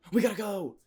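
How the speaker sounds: high, loud panic, we have to flee now; urgent panicked shout. Retold or imitated. Kid warns his friends to flee, in a panicked high shout. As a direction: yell it like you're about to get caught. Fleeing after falling through window.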